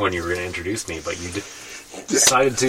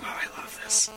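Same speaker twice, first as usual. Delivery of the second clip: high, soft mock rasp; imitating a hoarse voice; 8 dB quieter than usual. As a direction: mimic a friend's lost voice.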